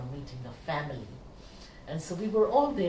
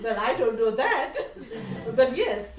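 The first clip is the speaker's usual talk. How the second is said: high, loud words laughing on; still laughing; 6 semitones higher, 7 dB louder than usual. Real, not acted. Answering the brother while still laughing. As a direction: talk through lingering laughter.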